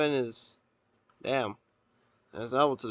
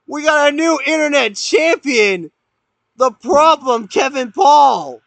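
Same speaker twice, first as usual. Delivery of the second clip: shrieking, very high, very loud call; screaming wrestling commentator; 11 semitones higher, 11 dB louder than usual. Real, not acted. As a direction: lose it at the big finish.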